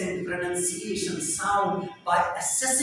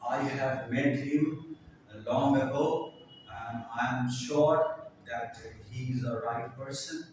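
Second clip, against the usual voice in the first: put-on his earlier Indian English accent, voice lowered; old Indian English accent; 8 semitones lower than usual. Speaker showing students his old Indian English accent, lower. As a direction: imitate how he spoke Indian English before. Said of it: "जैसे मैं कैसे बोलता था".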